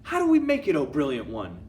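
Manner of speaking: very high, loud shrill cry; squealing witch chorus. Acted. Witches answering their leader, very high and loud. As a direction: squeal eager agreement.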